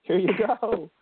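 sing-song spirit happily giving help; cheerful spirit voice. Retold or imitated. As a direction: play a chirpy, obliging spirit handing it over. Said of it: "Spirit says".

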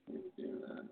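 breathy whispered echo. Real, not acted. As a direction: whisper it back to yourself. Echoing the prompt to himself.

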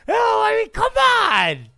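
high-pitched exasperated cry; exasperated disbelief. Real, not acted. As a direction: protest it way up high, incredulous. Exasperated reaction to what he described.

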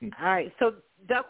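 usual speaking voice

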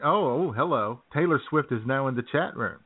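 ordinary delivery

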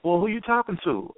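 high, protective mom demanding to know who's calling; protective mother. Retold or imitated. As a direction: voice the mom stepping in to guard her son. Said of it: "motherly defense mode".

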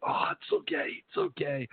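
imitating the hurt guy. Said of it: "He does that really well".

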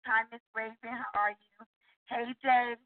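ordinary delivery

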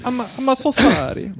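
high, hammed-up Italian accent. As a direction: play an over-the-top Italian waiter. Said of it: "could you do this in a nice Italian voice".

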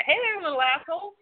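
high, cheery hey there little asshole; friendly teasing insult. Retold or imitated. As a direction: greet the grumpy dog like a buddy. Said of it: "in my head, I just went".

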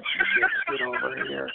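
stiff robot voice, squeaky first word. Pretend. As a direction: say it like a talking robot. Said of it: "In his robot voice".

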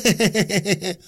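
high put-on giggle in her voice; mock giggle. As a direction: cackle like the politician you mock. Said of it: "I wonder if she giggled".

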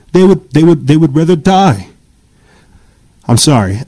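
ordinary talk, nothing marked

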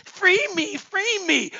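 high mock whine of a needy man; hammed-up pleading to be set free; mocking whiny plea. Preacher mocking men begging for deliverance, in a high whine. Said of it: "Running around like little girls".

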